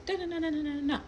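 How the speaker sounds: high, rattled-off imitation of someone yelling; mock yelling. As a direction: imitate a distant woman's rant in a high voice. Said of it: "who she's yelling at".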